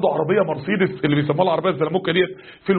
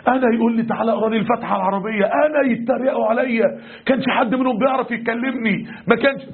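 retold outrage, pitched up; indignant, fuming youth; 3 semitones higher than usual. Newly devout youth fuming after his friends mocked him, indignant. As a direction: act out a wounded, fuming protest. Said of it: "عينيه مبرقة وودنه هتطلع دخان".

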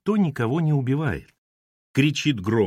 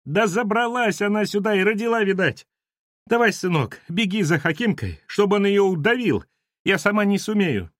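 high-pitched, plaintive urging; worked-up woman begging for help; agitated house manager; 9 semitones higher than usual. Acted. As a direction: wail out urgent orders in a high voice. Перепуганная домоуправша из подвала.

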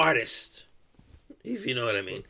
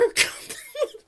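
laugh; 4 dB quieter than usual. Real, not acted. Laugh mid-rant.

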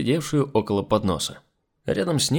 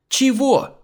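high, loud indignant question; flaring up; 13 semitones higher, 5 dB louder than usual. A narrator's reading. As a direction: flare up at the maid. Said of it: "взвилась Николета". (возмущённый высокий вскрик)

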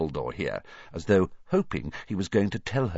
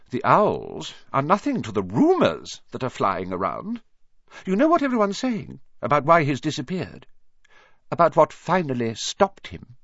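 insistent, higher-pitched professor's voice; probing professor; 7 semitones higher, 4 dB louder than usual. Acted. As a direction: press urgently for the truth as the professor.

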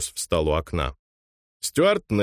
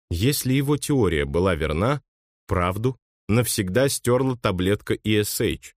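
ordinary delivery

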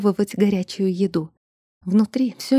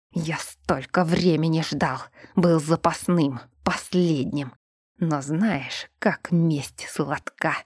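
menacing whisper; 3 semitones lower, 3 dB quieter, slower than usual. Acted. Demon threatens revenge.

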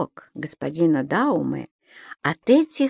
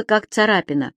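usual speaking voice

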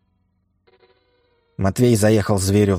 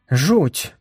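shocked gasp; 8 semitones higher than usual. Acted. Woman reacts to gossip.

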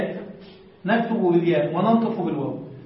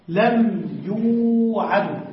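لم يوعد spelled out very slowly; slow teaching read of لم يوعد; slow demo; much slower than usual. Shown as a demonstration. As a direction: say لم يوعد slowly, each sound clear. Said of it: "نحو".

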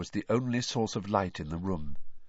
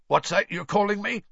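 high-pitched defiant question; 11 semitones higher, 4 dB louder than usual. A narrator's reading. Intruder bristles at an unknown name, defiantly. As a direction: challenge him back, pitched up. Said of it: "said Frank defiantly".

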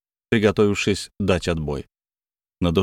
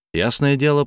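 ordinary delivery

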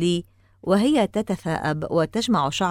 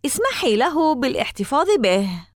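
mild call in a raised pitch; 6 semitones higher than usual. A narrator's reading. Sister to mother from inside the house, calling out. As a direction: call it out, higher but not shouted. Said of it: "تنادي من داخل المنزل".

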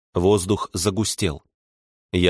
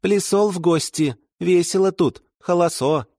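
put-on much higher, blurted toddler lisp; 10 semitones higher than usual. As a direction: burst out like an overexcited little kid. Toddler voice continues.

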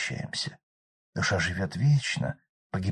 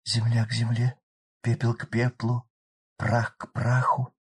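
funeral prayer; 4 semitones higher than usual. Acted. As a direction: intone it over the pyre. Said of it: "начала читать заупокоенную".

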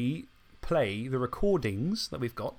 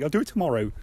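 put-on Dave accent; 2 semitones higher, 5 dB louder, faster than usual. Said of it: "That's my Dave accent".

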